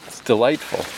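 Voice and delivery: ordinary talk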